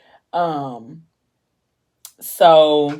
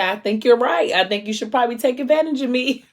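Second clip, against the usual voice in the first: higher, playful and teasing; flirty tipsy wife; 8 semitones higher than usual. Retold or imitated. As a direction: tease him with a tipsy flirt. Tipsy wife teasing her husband.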